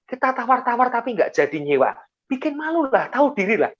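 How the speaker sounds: dismissive nagging. Retold or imitated. Pastor nudges his friends to go home embarrassed, nagging. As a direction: nag them to back off before embarrassment.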